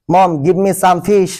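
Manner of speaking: very slow, each word apart; slow teaching drill. Shown as a demonstration. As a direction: drill it slowly for learners. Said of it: "বিভিন্ন ধরনের প্রয়োজন".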